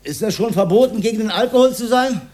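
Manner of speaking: retold outraged yell at the fascist; defiant shout. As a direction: shout it back at the commissar. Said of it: "ich ruf den Faschisten an".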